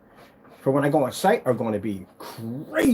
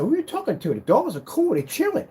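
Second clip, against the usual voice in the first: much higher, excited and dismissive; high, hyped-up retelling; 11 semitones higher than usual. Retold or imitated. Telling his buddy the dogs are fine, hyped and high-pitched. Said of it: "I said".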